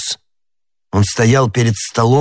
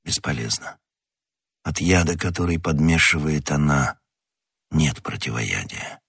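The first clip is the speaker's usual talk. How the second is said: low, very soft voice; hopeless resignation; 5 semitones lower, 7 dB quieter than usual. A narrator's reading. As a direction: say it quietly, all hope gone. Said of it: "сказал Атос". (тихо, низко, обречённо)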